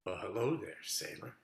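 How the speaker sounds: low breathy come-on; seductive whisper. Acted. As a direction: purr it like a vamp. Seducer greets in new costume.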